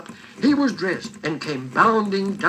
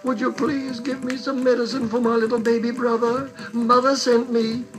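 lightly voiced little girl, higher; a small girl asking politely for help; little girl pleading; 6 semitones higher than usual. Little girl asking the nurse for medicine for her baby brother, lightly voiced child.

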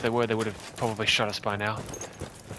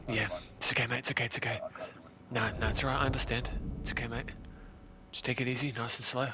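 reassuring; 9 dB quieter than usual. Real, not acted. Calming teammate after death.

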